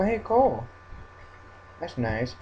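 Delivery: ordinary talk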